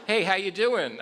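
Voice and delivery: breezy, high and casual hey; casual greeting. Retold or imitated. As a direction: toss it off like a regular guy. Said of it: "I might say".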